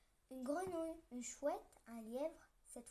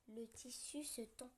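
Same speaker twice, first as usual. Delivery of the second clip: plain reading voice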